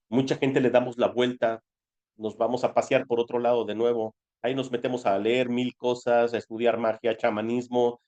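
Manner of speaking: ordinary talk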